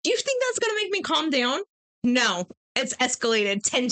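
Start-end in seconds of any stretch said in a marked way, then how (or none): 0.0-1.6 high-pitched incredulous challenge; mock indignant retort. Wife answering a man who says calm down, mock indignant. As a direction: throw it back in disbelief.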